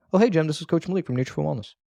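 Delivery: quick, offhand phone greeting; casually riffed off. Shown as a demonstration. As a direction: toss it off fast, like a normal thing. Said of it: "If I was just riff this off".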